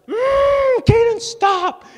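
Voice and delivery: put-on very high, drawn-out girlish squeal; Sadie. Dad imitating daughter as brother knocks down tower, piercingly high protesting squeal. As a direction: whine and shriek like an upset little sister. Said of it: "Sadie will be like".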